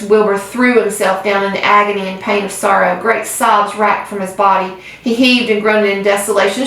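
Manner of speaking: usual speaking voice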